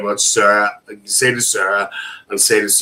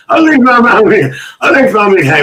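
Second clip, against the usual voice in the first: high, loud, rousing call; hollered catchphrase; 9 semitones higher, 6 dB louder, much faster than usual. Acted. Host repeats a viewer's greeting to the chat, hollering it.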